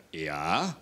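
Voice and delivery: understated low-pitched turning of the tables; deadpan comeback. Retold or imitated. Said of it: "Und ich sag".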